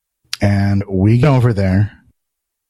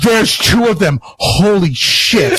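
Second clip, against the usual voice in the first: high-pitched, hammed-up disbelief; astonished; 8 semitones higher, slower than usual. Guard retelling his first sight of both twins, astonished. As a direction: reenact a stunned discovery, voice shooting up. Retold shock at seeing twins.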